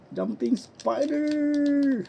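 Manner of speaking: high, slow stretched call; drawn-out announcement. Pretend. Stretched-out skill name.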